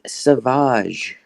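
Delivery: "savage" said as sa-VAHZH, drawn out; French-style pronunciation. Shown as a demonstration. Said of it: "Hey, it's pronounced savage".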